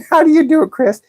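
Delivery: high, put-on feminine register. Shown as a demonstration. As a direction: hold the pitch well up like a woman's voice. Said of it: "get some of that feminine voice going on".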